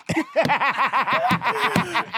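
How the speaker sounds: burst of laughter. Real, not acted. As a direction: break up laughing.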